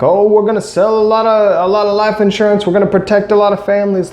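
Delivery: imitating a Southern accent, pitched high and quick; a rough stab at a Southern preacher-boss's accent. President boasting to his sales team, in a mock Southern accent. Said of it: "he had, like, a deep Southern accent".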